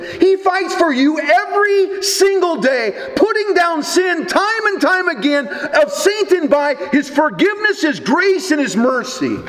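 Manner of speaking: fervent preaching. Acted. Preaching at full force.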